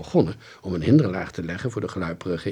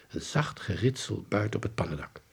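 ordinary delivery

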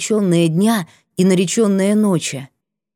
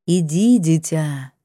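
goddess voice; 4 semitones lower, slower than usual. Acted. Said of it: "нежный голос богини строг и ясен".

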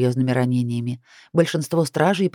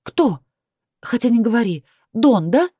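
indignant; 6 semitones higher, 4 dB louder than usual. A narrator's reading. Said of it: "не пыталась скрыть своего негодования".

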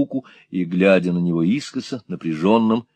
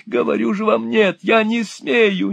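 put-on much higher, wailing old man in despair; a robbed old man shrieking in panic; frantic old miser; 12 semitones higher than usual. A narrator's reading. Old miser refuses in terror.

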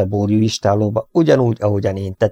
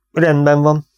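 forced breezy reassurance, a bit higher and brighter; false lightness; 6 semitones higher, 4 dB louder than usual. A narrator's reading. Vet leaving the farmer, faking lightness. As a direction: fake an easy tone to hide worry. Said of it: "mondtam hamis könnyedséggel".

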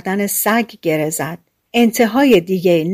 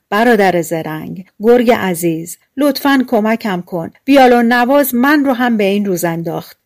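ordinary delivery

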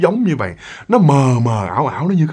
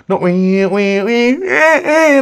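wailing oe oe, rising higher; baby crying imitation; 7 semitones higher, much slower than usual. Imitating her baby sibling crying, rising wail. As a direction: mimic a newborn's cry climbing up.

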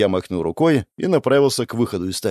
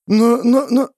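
put-on stammering girl; 10 semitones higher, 4 dB louder, slower than usual.

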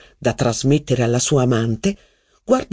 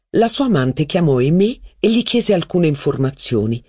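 ordinary delivery

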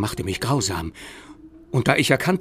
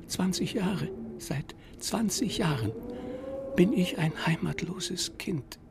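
lamenting; 7 semitones higher, 7 dB quieter than usual. Acted. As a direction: mourn every word. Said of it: "klagte die Stimme".

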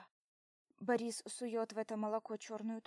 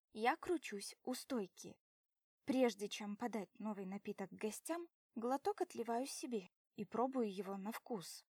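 usual speaking voice

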